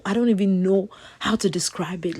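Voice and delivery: hushed awe; whispering. Real, not acted. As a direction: whisper it, amazed.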